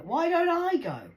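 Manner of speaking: high, loud disbelieving echo; incredulous. Retold or imitated. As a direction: throw the question back in shock.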